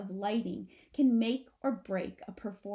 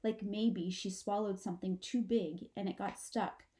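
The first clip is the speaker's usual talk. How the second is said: ordinary delivery, nothing marked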